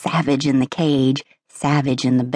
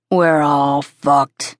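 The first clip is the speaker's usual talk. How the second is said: resigned; much slower than usual. A narrator's reading. Coach's weary resignation.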